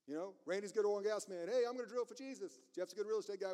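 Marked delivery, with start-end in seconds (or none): 1.5-2.6 high, playful mock eagerness; eager volunteer. Oil man offering his strength to Jesus, eager volunteer. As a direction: chirp it like a keen helper.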